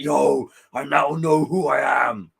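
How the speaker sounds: slow, loud dopey proclamation; drawn-out bro declaration; mock Venom surfer. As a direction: ham up the surfer-dude Venom line. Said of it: "he's just like".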